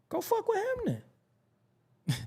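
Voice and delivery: high, incredulous mock rant; a hammed-up voice for a fed-up friend telling you to go; mock angry outburst. To a friend who defends the violator, a high mock angry outburst.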